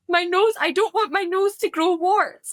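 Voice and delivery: put-on high, worried little-girl voice; fretting child. Mum voicing her daughter fearing her nose will grow, high and anxious. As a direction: plead like a scared toddler. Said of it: "she's like".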